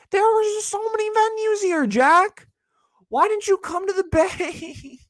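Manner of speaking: very high, strained whining plea; mock whine. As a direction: cry out to Jack half-laughing. Whiny plea to Jack.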